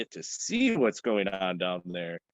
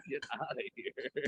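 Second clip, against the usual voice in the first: high, soft laughing shoo; playful laugh-filled shoo at the cat; 5 dB quieter than usual. Real, not acted. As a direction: giggle and wave the cat off.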